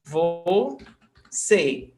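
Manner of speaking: "Você" split in two, vo, then cê; syllable by syllable. Shown as a demonstration. Teacher counting syllables for students, "Você" in two separate beats. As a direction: sound out "Você" with a gap between syllables. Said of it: "Two syllables".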